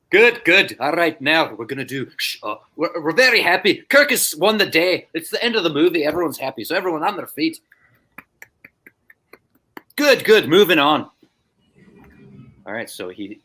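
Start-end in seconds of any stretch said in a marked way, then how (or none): 0.1-11.1 higher-pitched brisk Scottish assistant director; Scottish accent. Retold or imitated. Scottish AD cueing happy extras, high-pitched accented direction. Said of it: "should I try a Scottish accent?".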